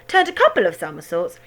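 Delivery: ordinary delivery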